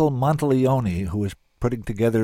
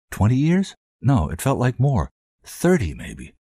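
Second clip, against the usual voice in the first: plain reading voice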